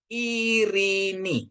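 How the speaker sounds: Irini sung out high and very slow, ei as one I; slow careful demo. Shown as a demonstration. Teacher demonstrates Irini slowly for the class. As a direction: hold each syllable of Irini like a model reading. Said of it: "Jadi bacanya".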